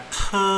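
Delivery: "ke" with a puff of air after the K; aspirated K. Shown as a demonstration. Teacher showing how K is read, aspirated "ke". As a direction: sound out "ke" with breath pressure. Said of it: "ada tekanan udaranya".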